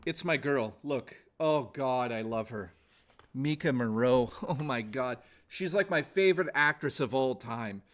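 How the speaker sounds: normal talking voice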